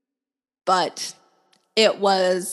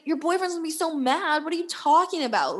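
high-pitched retold rant; a mild mock yell, pitched up; imitated yelling; 8 semitones higher, faster than usual. Retelling the man's argument about the boyfriend, mock yelling. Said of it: "he was yelling at me".

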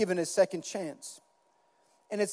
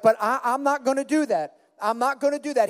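higher, louder protesting vow; emphatic vow; 5 semitones higher, 6 dB louder than usual. Acted. Peter protesting to Jesus that he will stay, emphatic vow. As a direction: insist on it, pitched up and forceful.

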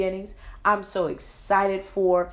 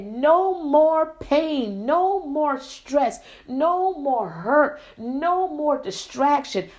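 drawn-out, higher-pitched proclamation; emphatic declaration; 6 semitones higher, slower than usual. Acted. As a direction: stretch out each "no more" with conviction. Voicing a firm declaration of faith.

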